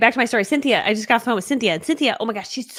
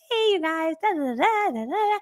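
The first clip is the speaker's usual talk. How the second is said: higher bright-toned presenter gloss; sweet presenter voice; 9 semitones higher than usual. Retold or imitated. Lady doing a training greets her audience sweetly. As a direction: put on a sugary on-camera voice.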